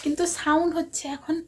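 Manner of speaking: hushed voice. Real, not acted. Secret birthday prep, hushed so sister can't hear. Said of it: "কথা বলতে পারছি না, দিদি শুনতে পাবে".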